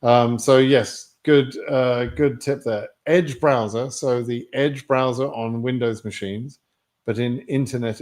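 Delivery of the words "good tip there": ordinary talk, nothing marked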